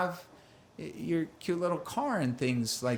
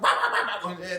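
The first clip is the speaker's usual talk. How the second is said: mock barking "rah rah", a bit louder; imitated dog bark; 4 dB louder than usual. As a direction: bark like the family dog, playfully. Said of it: "She's like".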